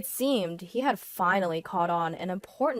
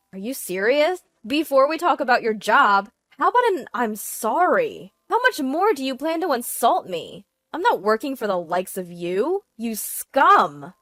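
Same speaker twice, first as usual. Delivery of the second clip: loud, higher-pitched rebuke; indignant anger; 4 semitones higher, 7 dB louder than usual. A narrator's reading. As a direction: tear into your cheating husband. Wife loses patience, insults him.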